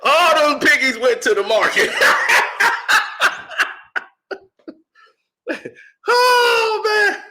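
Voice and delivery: loud laughing delivery. Real, not acted. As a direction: laugh through the line. Laughing at own joke.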